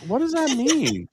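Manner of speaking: high puzzled what; mock baffled question; confused. Confused reaction retold.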